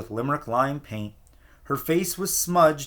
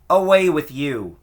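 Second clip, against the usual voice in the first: curt dismissal; 2 semitones higher, 7 dB louder than usual. A narrator's reading. Neighbour dismisses stranger.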